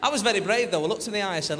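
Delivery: ordinary talk, nothing marked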